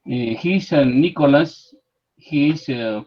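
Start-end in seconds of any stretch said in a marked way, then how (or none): none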